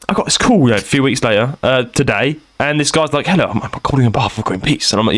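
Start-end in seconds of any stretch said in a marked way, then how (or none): 3.3-4.9 lightly imitated posh caller, low-pitched. Greenpeace caller greeting his petitioner, lightly posh and low. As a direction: do a mildly posh phone introduction, pitched down. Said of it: "he was posh".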